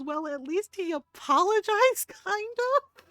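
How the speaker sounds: half-laughing as the amused mom; a mock mom voice breaking into laughter. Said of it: "his mom started laughing, going".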